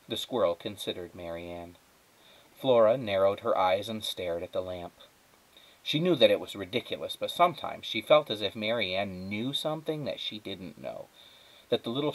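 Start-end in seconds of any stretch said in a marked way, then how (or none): none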